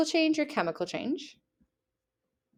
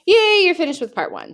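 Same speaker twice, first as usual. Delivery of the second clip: much higher and louder happy yay; excited cheer; 10 semitones higher, 8 dB louder than usual. Real, not acted. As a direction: cheer them on for finishing part one. Cheering students for finishing.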